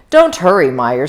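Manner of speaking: usual speaking voice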